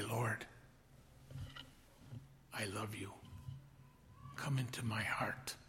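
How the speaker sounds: hushed whispered prayer to Jesus. Acted. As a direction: breathe the prayer intimately. Modelling a quiet sinner's prayer.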